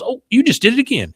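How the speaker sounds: high, accusing exclamation; Peggy. Acted. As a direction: catch him red-handed. Peggy catching Hank in the act.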